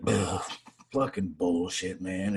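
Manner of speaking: low, soft, slow mock misery; miserable grumble. Miserable sailor answering a greeting, low slow grumble. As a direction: groan it like a hammed-up Eeyore. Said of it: "he was just miserable".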